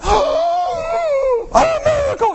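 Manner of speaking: very high, loud mock gasp; awestruck crowd. Preacher mimicking a crowd seeing devils cast out, awestruck. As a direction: squeal it in exaggerated falsetto amazement. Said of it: "And they're all going".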